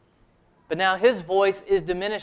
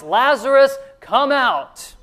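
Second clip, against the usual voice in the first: high, loud preacher's call; ringing command to a man in the tomb; loud cry; 9 semitones higher, 7 dB louder, slower than usual. Acted. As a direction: call it out loudly, pitch climbing. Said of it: "cried out with a loud voice".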